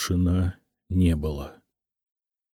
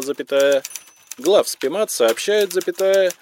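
measured official dictation with spoken punctuation; high, monotone bureaucratic readout; telephonogram dictation; 5 semitones higher than usual. A narrator's reading. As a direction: dictate a formal message word by word.

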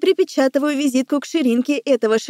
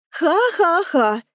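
slow, higher, syllable-by-syllable "ha-ha-ha"; mocking fake laugh; 5 semitones higher, much slower than usual. A narrator's reading. Woman mimicking laughter at her friend's words, mocking and deliberate. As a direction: clown it, pronouncing each syllable separately. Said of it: "Кривляюсь я на слова подруги, громко произнося каждый слог".

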